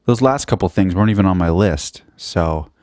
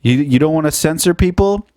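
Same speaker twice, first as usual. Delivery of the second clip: normal talking voice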